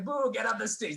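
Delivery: high, loud jeering boo; mock heckle. Dogs heckle the comic off stage, high mock jeer.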